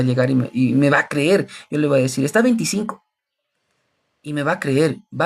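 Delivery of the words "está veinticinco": sly seller. Retold or imitated. Seller quoting inflated price.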